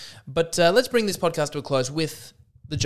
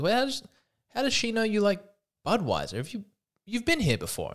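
suspicious wife; 8 semitones higher than usual. Acted. Wife questions husband again.

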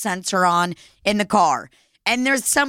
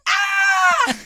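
mock startled shriek, much higher; a short retold scream of fright, played up. Startled by Rafe in mask.

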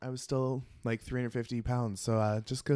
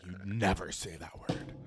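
put-on stern older sister; 4 semitones lower than usual. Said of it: "She looked at me very sternly and was like".

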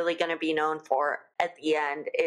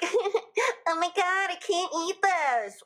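high, slow airy mock voice; sending up a precious dieter; mock cutesy girl; 12 semitones higher, slower than usual. Mocking cutesy gluten-free eaters in a high, slow voice.

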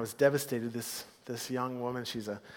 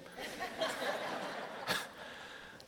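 a high airy giggle, much higher; high laugh; 7 dB quieter than usual. Real, not acted. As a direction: let a squeaky laugh escape. Laughing after candy joke.

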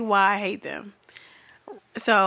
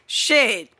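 usual speaking voice

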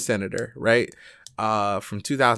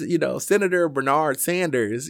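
ordinary talk, nothing marked

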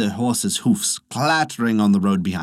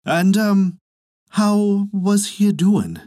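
put-on much higher, slow fond old woman; old wife's voice; 9 semitones higher, slower than usual. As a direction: ask tenderly after the old man. Old wife asks about husband.

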